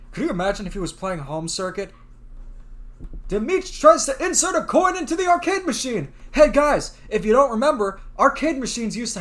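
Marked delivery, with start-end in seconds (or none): 3.3-6.1 narrator voice. Acted. Reads game narration.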